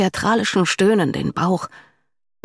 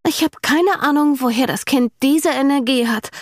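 higher-pitched, lightly sighed wonder; mock-weary friend, pitched well up; sighing with amusement; 8 semitones higher than usual. A narrator's reading. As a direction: sigh it fondly, with a smile in the voice. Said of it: "seufzte Brooke".

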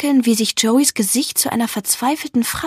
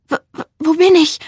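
higher, louder, startled stammer; 6 semitones higher, 5 dB louder than usual. A narrator's reading. Jumping up from a nightmare, stammering. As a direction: stutter the first word in confusion. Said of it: "stammle ich".

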